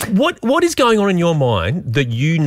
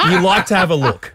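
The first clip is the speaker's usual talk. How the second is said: laughing; 3 semitones higher than usual. Real, not acted. Teasing co-host while laughing.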